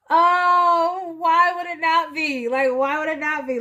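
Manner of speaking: high and loud, tumbling out; excited realization. Real, not acted. Reader realizing who changes the Emperor's mind, excited and loud. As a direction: burst out as it clicks.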